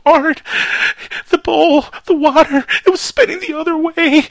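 frantic high, loud caller in pieces; Area 51 caller. Acted. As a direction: gasp out the news in a panicked high voice. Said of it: "good impersonation of the Art Bell Area fifty-one caller".

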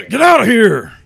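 high, shouted panic impression; Nicolas Cage. Warning everyone they will die, hammed-up high panicked shout. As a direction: yell it like an overwrought action hero. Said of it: "Are we talking like Cage?".

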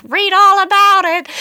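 shouted very high and loud street cry; paper boy. Retold or imitated. Paper boy hawking papers, loud high shouted cry. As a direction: bellow it like an old newsie on the corner. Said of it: "like, a paper boy".

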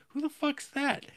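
sudden high incredulous question; startled. Retold or imitated. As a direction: jump at the figure from the shadows. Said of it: "And you're like".

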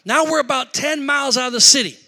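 usual speaking voice

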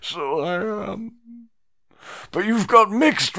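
high, slow, weepy pleading; sobbing in high treble. A narrator's reading. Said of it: "began to sob in a high treble key".

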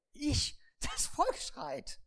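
high indignant Ich, then a slow drawn reply; Moses protesting. Acted. Moses protests to God that the people scream. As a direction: protest your innocence.

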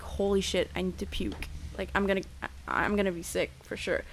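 low, hushed retold thought to oneself; queasy realisation. Drunk woman in bed realising she needs to puke, queasy and hushed.

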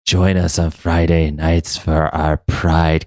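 slow, low spooky haunted house voice; Caliban voice. Pretend. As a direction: creep out each name slowly, like a haunted house host. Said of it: "do that Caliban voice again".

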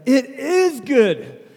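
high, loud, drawn-out mock-epic cry; triumphant exultation. Suitor hearing she likes him back, jubilant shout. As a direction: proclaim it slowly with laughing jubilation. Retold triumph at her answer.

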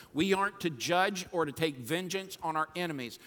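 ordinary delivery

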